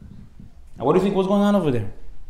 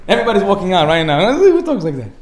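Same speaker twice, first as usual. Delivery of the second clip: laughing; 4 semitones higher, 4 dB louder than usual. Real, not acted. Laughing reaction to rude imitation.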